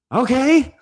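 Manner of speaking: very high, impatient okay; put-on exasperated husband; annoyed Brooklyn husband. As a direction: humour your wife in a high, irritated voice. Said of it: "he's annoyed".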